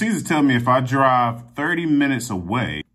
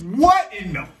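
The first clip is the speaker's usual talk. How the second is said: exasperated; 12 semitones higher, 6 dB louder than usual. Real, not acted. Wrong kit handed over.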